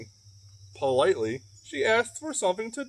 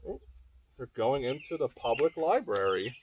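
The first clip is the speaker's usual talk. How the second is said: usual speaking voice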